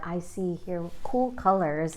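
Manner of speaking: usual speaking voice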